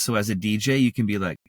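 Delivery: ordinary talk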